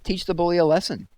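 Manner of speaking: high, loud eager goading; Mister Pendanski urging Stanley on, high and loud; egging on. A narrator's reading. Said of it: "said Mister Pendanski".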